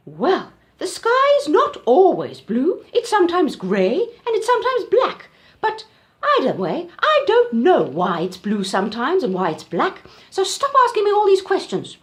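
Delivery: high, loud, exasperated auntie; irritated Auntie Giraffe. Acted. Auntie Giraffe telling the elephant off, high and loud. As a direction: scold like a fed-up giraffe aunt.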